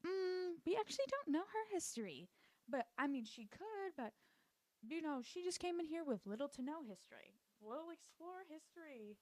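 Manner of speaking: high, hesitant mock-polite voice; hedging care worker. Lady explaining Sarah to Nina, high hedging voice.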